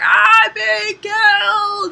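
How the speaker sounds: hammed-up victim howling for help; mock dying scream. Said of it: "the other guy is like".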